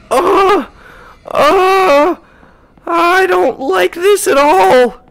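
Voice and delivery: high panicked whimpering cries; panic, pleading high and loud; scared horror game streamer. Real, not acted. Panicking as ghost appears.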